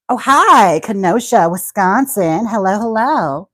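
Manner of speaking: loud, high, gushing hello; excited greeting. Pretend. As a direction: greet a fan at full volume, drawing out the last hello. Greeting a viewer from Kenosha.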